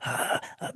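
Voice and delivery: breathless imitated gasps; short whispered gasps of alarm; gasping. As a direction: mimic people gasping in shock. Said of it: "everybody was going".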